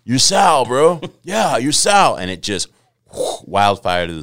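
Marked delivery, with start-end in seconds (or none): 0.0-2.2 loud, high SoCal bro voice; SoCal accent. Retold or imitated. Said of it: "your SoCal voice is really good".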